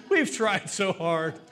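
slow, rueful words through laughter; laughing. Real, not acted. Preacher admitting we all tried selfishness, amused and laughing. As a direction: drag it out, still laughing.

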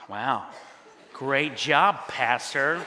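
low, unimpressed mock praise; sarcastic praise. God mocks his behaviour, retold.

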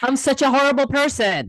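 put-on self-blaming kid. A guilty kid berating herself, in a mock high wail. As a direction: play the kid who sees herself as awful. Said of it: "other kids who apologize excessively".

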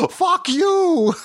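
put-on high gleeful kid curse; kid's high voice. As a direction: play a delighted little boy cursing. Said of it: "he'll be like".

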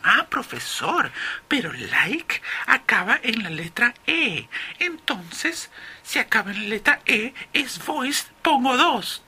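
very high pupil voice; mistaken student. Acted. Student argues from spelling to the professor, high put-on student voice. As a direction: impersonate a student reasoning aloud in falsetto. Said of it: "algunos alumnos dirán".

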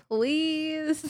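high, soft, pouting please; pouty please. Retold or imitated. Child pleading with her father, pouting. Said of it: "that's exactly the face I would do".

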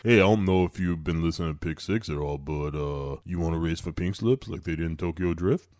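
low, soft lazy creep; Matt Dillon voice. Retold or imitated. Cohost voicing Matt Dillon to Lindsay, low lazy creep. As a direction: drop low and mumble it out.

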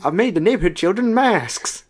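high, gleeful sinister boast; mock creepy villain. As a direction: boast like a cheerful psycho. Plays creepy character, laughing.

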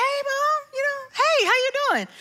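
put-on very high, casual teen greeting; high-pitched breezy "hey, Mom"; innocent son. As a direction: imitate a too-innocent kid picking up the phone. Unsuspecting son answers phone, voiced.

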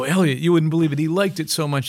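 high, loud, elated retelling; excited report. Retold or imitated. Band tells the manager [name] liked it, excited and loud. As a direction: burst out with the amazing news.

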